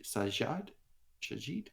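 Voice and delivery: normal talking voice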